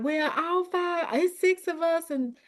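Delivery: high, mildly acted self-doubt; doubting group member. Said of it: "other people's like".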